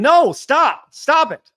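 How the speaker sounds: high, loud no stop; high loud mock protest. Real, not acted. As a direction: shout it down, half joking. Protesting chat message.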